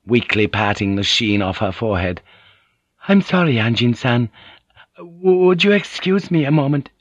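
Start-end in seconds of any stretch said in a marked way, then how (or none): 3.1-6.9 high, faltering apology; queasy polite lady. A narrator's reading. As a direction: excuse yourself delicately in a high voice. Mariko excuses herself, queasy.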